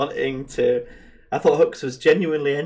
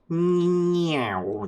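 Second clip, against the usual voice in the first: high playful meow; cat meow; 6 semitones higher than usual. Pretend. Cat noise imitation.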